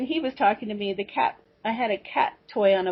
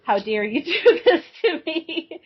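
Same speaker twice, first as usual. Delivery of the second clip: higher, louder outrage breaking into a laugh; mock outraged scream; 7 semitones higher, 3 dB louder than usual. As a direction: play the fury, laughing through it. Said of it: "screaming in my head".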